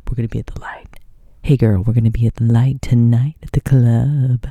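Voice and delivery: breathy seductive whisper, hammed up; mock sultry whisper. Michael Jordan inviting Candace to the club, mock seductive whisper. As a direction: purr it like a smooth-talking celebrity. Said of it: "He didn't say it like that".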